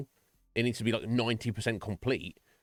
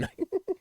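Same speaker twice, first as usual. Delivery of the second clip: squeaky high-pitched giggle; real laugh at his own joke. Real, not acted.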